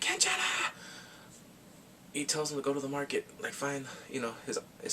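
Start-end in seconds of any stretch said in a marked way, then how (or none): 0.0-0.7 very high, thin call; mock high-pitched holler; imitated calling out. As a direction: call it out high without real volume. Acting out a man calling out.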